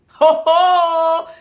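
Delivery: high, booming "ho, ho" hoot; big mock-amazed holler; loud exclamation. Exclaiming about loud market women.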